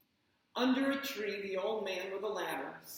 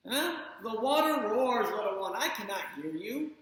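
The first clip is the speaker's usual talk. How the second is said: raised voice over roaring water; loud calling over water; 5 dB louder than usual. Acted. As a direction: call out over the noise of water. Mother can't hear over water.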